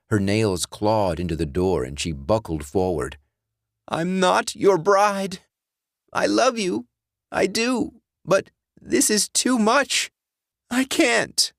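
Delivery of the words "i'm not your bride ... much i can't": much higher, pleading, near tears; tearful Alice. Acted. Alice refusing her dead ex, high tearful pleading. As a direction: plead through rising tears.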